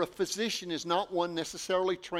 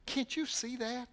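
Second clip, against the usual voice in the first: ordinary talk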